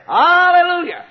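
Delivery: high loud shouted alleluia; practiced shout. Shown as a demonstration. Preacher demonstrates heaven's praise, loud high shouted alleluia. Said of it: "practice up and say".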